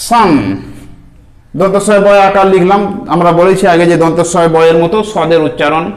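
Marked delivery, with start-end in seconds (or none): 0.1-0.3 সান said SAAN, s plus long a plus n; modelling for students; sounded out. Shown as a demonstration. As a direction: give সান as one clean syllable. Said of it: "সদ দু জাবার".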